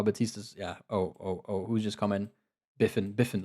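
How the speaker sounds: normal talking voice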